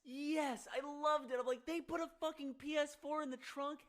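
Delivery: high, loud gushing; real excitement. Real, not acted. Recalling Pimp My Ride, loud thrilled outburst. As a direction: burst out with fan enthusiasm.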